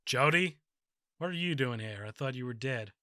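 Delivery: mild, quiet wooden delivery; understated bad acting, barely reacting; Reggie's flat line reading. Mimicking the actor's wooden reaction to his dead friend. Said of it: "I'm gonna try replicate the exact performance that Reggie Bannister gives".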